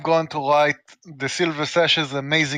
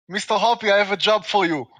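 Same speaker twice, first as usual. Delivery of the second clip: normal talking voice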